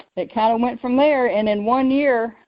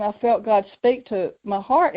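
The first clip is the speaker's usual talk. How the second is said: plain reading voice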